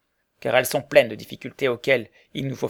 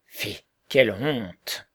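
very slow, drawn-out cry of disapproval; mock outcry; 3 semitones lower, much slower than usual. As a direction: act out a scandalised exclamation, stretched out. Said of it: "vous êtes toujours prêt à vous écrier".

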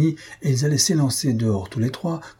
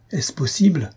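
higher-pitched cry of disbelief; shocked exclamation; 6 semitones higher than usual. A narrator's reading. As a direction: exclaim in mild, stunned emotion. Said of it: "s'écria Silvius, en proie à une si violente émotion".

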